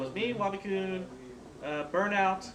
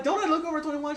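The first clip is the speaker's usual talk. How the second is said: indignant; 9 semitones higher, 5 dB louder than usual. Retold or imitated.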